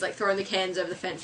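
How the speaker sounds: ordinary talk, nothing marked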